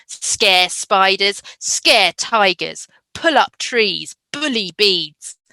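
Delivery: loud, punchy, slow angry reading; angry poem read aloud. Shown as a demonstration. Teacher reads an angry poem to children, loud and punchy. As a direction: read the anger poem building and stamping. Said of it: "as I read it to you".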